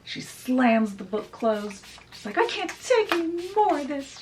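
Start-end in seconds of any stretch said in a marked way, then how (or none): 2.4-4.2 high, acted exasperation; exasperated outburst. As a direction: burst out as a fed-up fairy godmother. Fairy godmother slams book, fed up.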